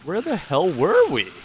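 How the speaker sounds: high, loud, slow exasperated cry; frustrated outburst. Real, not acted. Gamer lost looking for the boat, loud frustrated cry. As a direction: throw the question up in frustration.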